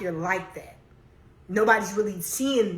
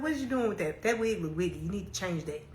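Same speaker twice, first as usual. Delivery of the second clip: imitating blunt mom; 7 dB quieter, faster than usual. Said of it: "Then she's like".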